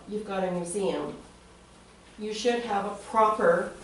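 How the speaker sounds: ordinary delivery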